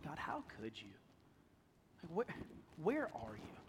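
hushed, soft plea to God; barely voiced hurt questioning; anguished prayer. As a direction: retell a private, broken prayer quietly.

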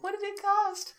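put-on high child's voice; little girl voice. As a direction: pipe it up like a small girl. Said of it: "she's like".

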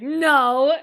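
loud mock refusal; acting out his refusal. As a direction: blurt it out loudly, imitating him. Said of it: "he's like".

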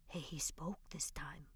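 whispered. A narrator's reading. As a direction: let it slip out in a trembling whisper. Old man whispering ghost news.

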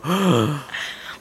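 big mock gasp; shocked gasp. Podcaster acts out shock at the brother reveal, gasping. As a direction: gasp at the plot twist. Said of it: "And I go".

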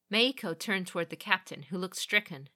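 plain reading voice

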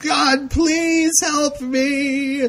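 high, very slow hammed-up wail; mock sobbing plea. Host mocking Patty's sobbing prayer, wailing. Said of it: "Patty starts sobbing".

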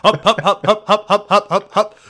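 rhythmic grunted hups; chanted hups. Acted. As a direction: chant it like marching jocks. Chanting hup.

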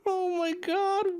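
high, drawn-out, overwhelmed; choked-up disbelief. Real, not acted. Streamer overwhelmed by chat's find, choked-up disbelief. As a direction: squeak it out slowly, overcome. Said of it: "I'm getting kinda choked up".

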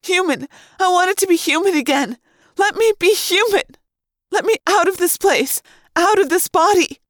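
high, building outcry; desperate plea. A narrator's reading. Trapped Cassie pleads to be human, desperate. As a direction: beg frantically to be let out.

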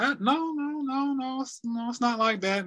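high, hurried no-no-no protest; mild retold protest to roommate. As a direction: brush it off, insisting. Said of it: "I'm like".

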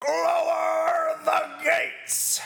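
very high, drawn-out yell; imitating a bellowing gatekeeper; arena announcer. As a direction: stretch each word into a straining cry. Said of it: "My voice is fucked between that".

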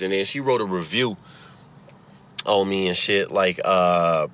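normal talking voice

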